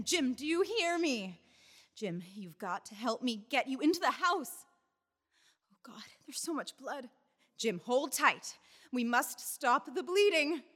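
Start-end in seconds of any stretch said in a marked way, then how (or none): 5.7-7.1 soft whispered dread; horrified whisper. Acted. Wife seeing the bleeding, horrified whisper. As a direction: whisper it in horror.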